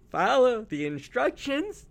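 high slow exasperated command; exasperated shout. Acted. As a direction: lose patience and bark it.

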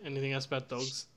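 ordinary talk, nothing marked

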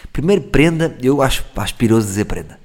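usual speaking voice

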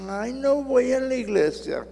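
high, mocking whiny excuse; mock whine. Preacher mocks a member skipping church, in a high whine. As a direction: ham up a lazy churchgoer's complaint.

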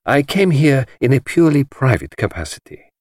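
put-on prim French-accented reply. A narrator's reading. Poirot deflects Chaitana in a light French accent. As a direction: say it with polite French dignity. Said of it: "said Poirot".

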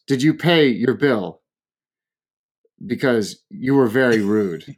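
mimicked slow, deliberate reproach; slow scolding. Woman by the door to rude diner, scolding slowly. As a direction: spell it out for a rude stranger, slowly. Stranger repeats question, scolding.

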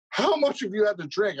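mock cop question, pitched well up; retold cop questioning. Cop to a drunk man on the ground, retold high-pitched question.

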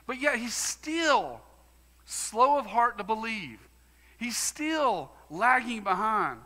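high, loud pulpit preaching, pressing hard; full-force preaching. Acted. Preacher to congregation, full-force preaching.

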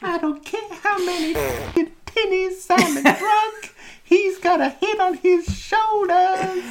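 very high, loud impression; [name]. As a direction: squeal it as the pop star.

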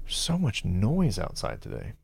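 ordinary delivery

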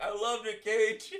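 high, loud, drawn-out declaration; mock loud confession. Imitating a friend finally admitting it, mock loud confession. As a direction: cry it out slowly, half laughing.

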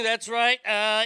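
usual speaking voice

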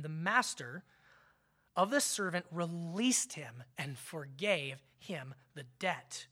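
ordinary delivery

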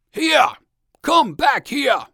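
high, loud bellow of an angry bear; furious storybook bear yelling; angry shout. A narrator's reading. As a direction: shout it hard, calling back escaped prey. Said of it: "cried the bear".

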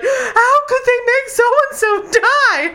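high, slow wailing mock outrage; hammed-up fan despair; mock outraged fan. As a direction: whine it like a devastated fan. Mocking confused fans' outrage.

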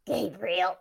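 put-on hoarse whispered accent; raspy whisper. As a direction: rasp it out in a whisper. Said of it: "her accent in a raspy whisper".

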